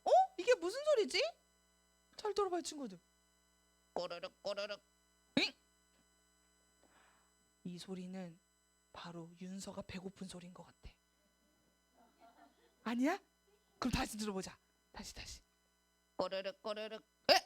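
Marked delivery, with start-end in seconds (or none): 3.9-4.8 tummy rumble sound. Acted. 16.1-17.0 tummy rumble sound. Acted.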